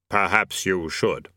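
slow, deep, measured Death. A narrator's reading. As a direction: intone it slowly as Death. Death speaks.

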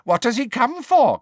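a suspicious house elf muses; wondering elf. A narrator's reading. Elf wonders aloud about the boy in the fire, squeaky. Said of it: "Creature wonders".

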